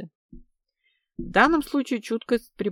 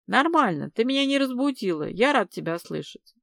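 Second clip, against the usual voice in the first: ordinary delivery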